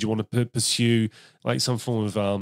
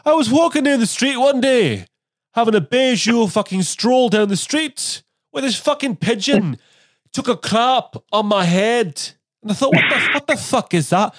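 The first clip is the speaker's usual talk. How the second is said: high, loud Scottish storyteller; Billy Connolly; 11 semitones higher, 5 dB louder than usual. Acted. Comedian shows how Billy Connolly sells a plain story, broad loud Scottish impression. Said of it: "an example of that is Billy Connolly".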